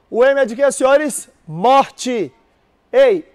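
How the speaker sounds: ordinary delivery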